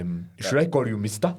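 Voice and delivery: Ugandan host accent, mock formal. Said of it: "that's a good accent".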